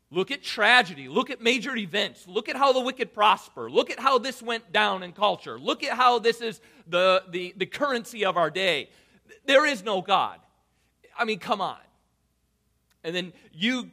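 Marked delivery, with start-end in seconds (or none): 0.1-11.8 forceful skeptic argument. Acted. Preacher voicing skeptic's case.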